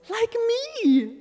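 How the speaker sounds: put-on eager child calling out Like me; bright proud exclamation, loud on me; excited kid shout. Son leaning forward in church, proudly shouting.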